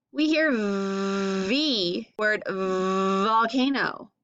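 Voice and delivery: slow buzzing "V" in "volcano"; stretched V sound. Shown as a demonstration. Teacher modelling V for kids, slow and buzzy. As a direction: draw out the buzz on "V". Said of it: "put your teeth on your lip".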